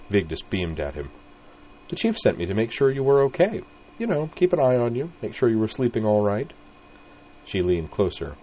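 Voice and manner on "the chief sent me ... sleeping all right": put-on higher, sweet teasing girl voice; a cheeky redhead fussing over him; playful young woman. Vigdis voiced by reader.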